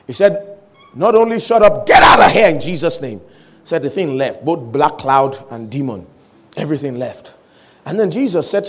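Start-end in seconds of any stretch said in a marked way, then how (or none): 0.9-3.2 loud command, punching "get out"; commanding rebuke. Acted. Man orders the demon out in Jesus' name, forceful and loud. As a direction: cast it out forcefully.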